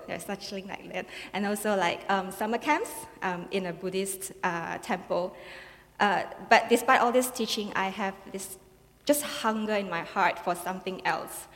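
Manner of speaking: ordinary delivery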